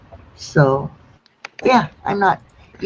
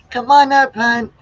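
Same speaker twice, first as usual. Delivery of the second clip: raised, bright call across the room; 7 semitones higher, 5 dB louder than usual. Real, not acted. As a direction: call her up warmly and loud. Calling a viewer up.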